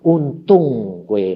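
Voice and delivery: very slow, drawn-out Javanese teasing. Retold or imitated. God teasing the grumbling servant, very slow. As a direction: drag each word out, gently mocking.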